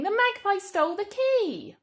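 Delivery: shocked. Shown as a demonstration. Teacher reading the statement as shocking news. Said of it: "it is quite shocking".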